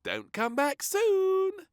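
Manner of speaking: put-on high, slow child's plea; high, slow little girl; little princess voice. A narrator's reading. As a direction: ask sweetly like a little girl.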